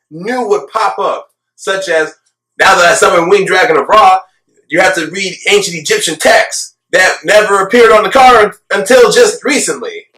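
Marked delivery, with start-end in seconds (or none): 2.6-10.1 mock announcement. Mocking made-up card rules.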